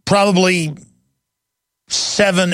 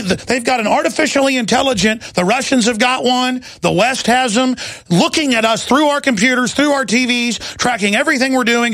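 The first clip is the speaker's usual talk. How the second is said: ordinary talk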